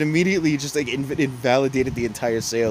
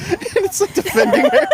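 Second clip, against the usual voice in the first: high, loud laughing through words; giggling disbelief; 9 dB louder than usual. Real, not acted. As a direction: squeal it out while laughing.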